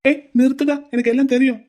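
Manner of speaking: loud sharp order to stop; angry stop. Acted. Groom silencing his friend mid-excuse, angry. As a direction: cut him off hard.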